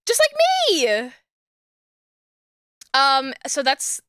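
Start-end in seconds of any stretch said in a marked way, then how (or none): 0.1-1.1 delighted squeal. Real, not acted. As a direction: squeal it, holding the last word high. Delighted likeness to mascot.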